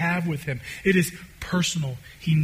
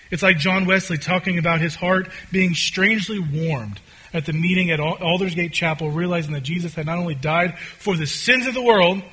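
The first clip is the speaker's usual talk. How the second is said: ordinary talk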